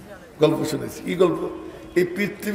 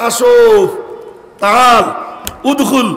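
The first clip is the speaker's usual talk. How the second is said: raised welcoming call, higher and louder; calling him in; 5 semitones higher, 4 dB louder, slower than usual. Retold or imitated. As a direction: call out warmly to come in. Prophet invites Umar in. (উঁচু গলায় ডেকে ভেতরে আসতে বলা)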